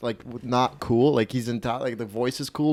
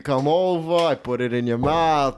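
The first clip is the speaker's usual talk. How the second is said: slow, drawn-out Italian wise guy, pitched up; 4 semitones higher, slower than usual. Acted. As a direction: stretch it like a pushy Italian lothario. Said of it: "This Italian guy sounds like he has riz".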